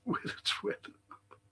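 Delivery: breathy undertone repeat; muttered echo. Retold or imitated. Angry about the weather map, muttered repeat.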